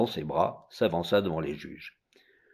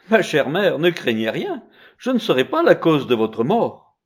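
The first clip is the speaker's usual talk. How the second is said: put-on child's voice; 7 semitones higher, 6 dB louder than usual. The child answers his mother.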